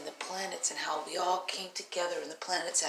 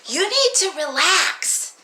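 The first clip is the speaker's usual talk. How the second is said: higher and louder, slow "relax"; cheeky kid advice; 8 semitones higher, 9 dB louder, slower than usual. Retold or imitated. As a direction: tell the teacher to chill, cheeky. Child tells teacher to relax.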